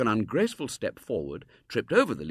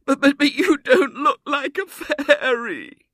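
put-on very high, loud, stuttering little girl; sobbing wail; 14 dB louder, slower than usual. A narrator's reading. Girl losing hope in William's plan, sobbing wail. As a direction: wail it, stretching the last word out. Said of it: "burst into a wail".

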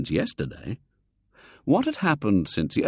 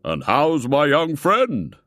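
put-on slow, louder, beaming old man; hearty old gentleman; 6 semitones higher, 5 dB louder, slower than usual. A narrator's reading. Foulard greeting William, slow and jovial. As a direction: greet the boy with jolly condescension. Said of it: "Mister Foulard was saying".

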